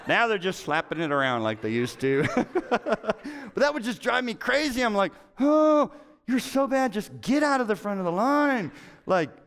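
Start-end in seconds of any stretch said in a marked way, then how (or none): 5.4-8.7 much higher and louder mock yell at a teammate; exasperated yell. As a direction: shout it at a weak player in mock scorn. Yelling at weak volleyball teammates.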